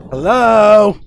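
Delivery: loud hello into the house; calling out. Acted. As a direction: call out to an empty room. Mother calling out for husband.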